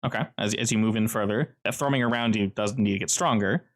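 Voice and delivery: ordinary talk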